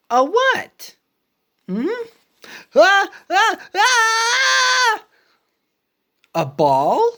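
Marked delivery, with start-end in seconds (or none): 2.7-5.0 sneeze build-up. Acted. Gerald about to sneeze again.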